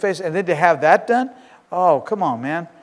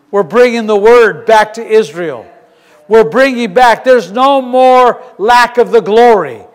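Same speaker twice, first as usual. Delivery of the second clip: loud, slow building sermon cry; preaching at full force; 4 semitones higher, 8 dB louder than usual. Acted. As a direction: preach it out to the hall.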